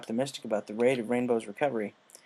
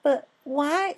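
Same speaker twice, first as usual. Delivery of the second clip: high little filly protest; 3 dB louder than usual. Acted. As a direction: squeak out a protest, much higher. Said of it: "she started to protest".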